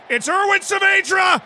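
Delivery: high, loud goal yell; celebrating a goal; excited shout. Real, not acted. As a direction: scream the scorer's name.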